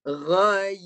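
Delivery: "Ghai" loud, ending in a clear yi; audible yi. Shown as a demonstration. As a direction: bring out the closing yi in "Ghai". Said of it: "Ada bunyi yi tu".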